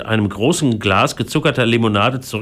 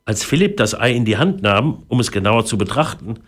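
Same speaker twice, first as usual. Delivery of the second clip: usual speaking voice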